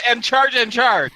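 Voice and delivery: mock-quote it with a jab; mocking imitation. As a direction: parrot the repeated phrase to tease. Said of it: "Use something other than".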